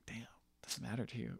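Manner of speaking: whispered in amazement; awed whisper. Retold or imitated. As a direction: breathe it out, stunned by the line. I'm like.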